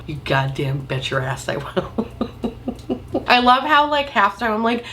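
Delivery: ordinary delivery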